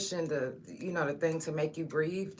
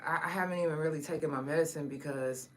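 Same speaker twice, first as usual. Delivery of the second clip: usual speaking voice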